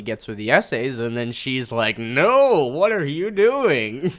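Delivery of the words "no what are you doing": higher, louder, drawn-out disbelief; mock exasperation. Recapping her reaction to his gift, mock exasperation. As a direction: draw out the question in mock dismay.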